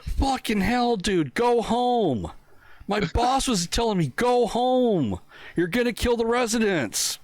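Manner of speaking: high, exasperated mock coworker; hammed-up horrified colleague; appalled coworker. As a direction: shoo him home in disbelief. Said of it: "They're like".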